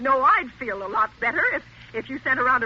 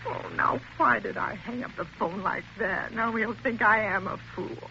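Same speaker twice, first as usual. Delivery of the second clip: soft, low, fretting to herself; an anxious woman mumbling alone after hanging up; quiet regret; 5 semitones lower, 6 dB quieter than usual. Acted. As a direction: scold yourself under your breath.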